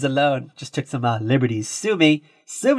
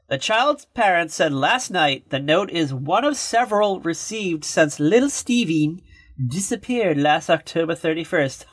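ordinary talk, nothing marked

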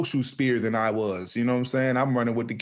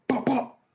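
much higher, clipped imitated gunfire pops; gunshot pops imitated; 13 semitones higher than usual. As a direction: spit out the pops like distant shots.

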